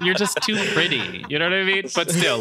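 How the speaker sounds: giggling while speaking; laughing. Real, not acted. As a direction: talk through a laugh.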